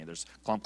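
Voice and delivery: low, far-off thud of a walker; imitated walker clump. Preacher imitating the old woman's walker approaching, low thud. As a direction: make the dull thump of a walker. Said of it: "I hear this bizarre sound".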